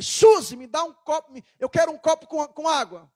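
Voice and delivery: loud bossy demand to the wife; rude demand. Retold or imitated. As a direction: bark the request like an order. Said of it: "isso é jeito de falar?".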